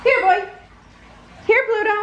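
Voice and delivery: friendly sing-song call; calling the dog. A narrator's reading. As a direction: call out across the house for Pluto. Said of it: "called Mickey".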